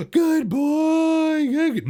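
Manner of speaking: high, loud gushing praise; overexcited praise. Shown as a demonstration. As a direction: gush over the dog, way too much. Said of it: "not going".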